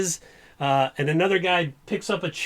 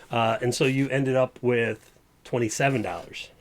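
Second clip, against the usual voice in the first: ordinary talk, nothing marked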